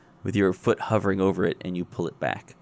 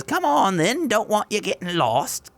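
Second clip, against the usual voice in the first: high-pitched eccentric woman; sing-song, strange lady voice; high odd woman voice; 11 semitones higher than usual. Acted.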